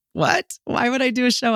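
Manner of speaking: high, squeaky what?; shocked she'd ever host; stunned disbelief. Retold or imitated. Told to host a show, she squeaks in disbelief.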